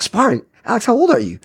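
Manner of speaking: high-pitched disbelieving doctor; imitating an appalled friend's scolding; retold incredulity. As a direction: question it in mock disbelief. Doctor scolding him in retold exchange.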